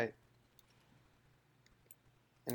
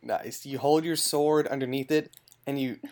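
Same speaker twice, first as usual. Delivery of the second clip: ordinary delivery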